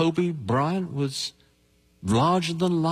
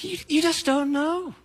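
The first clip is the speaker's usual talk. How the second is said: urgent cry; 13 semitones higher than usual. Acted. Urgent warning in sermon.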